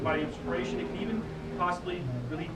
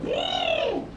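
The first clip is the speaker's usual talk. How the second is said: siren wail. Shown as a demonstration. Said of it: "We're gonna make a siren".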